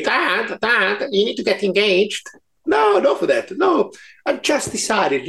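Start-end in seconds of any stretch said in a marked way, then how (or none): 0.0-2.3 imitating daughter. Quoting daughter nagging him.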